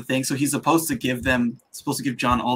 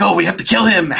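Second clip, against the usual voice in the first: raised, loud mock villain; 6 semitones higher, 5 dB louder than usual. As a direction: ham up the bloodthirsty strangers. Strangers voiced in retelling.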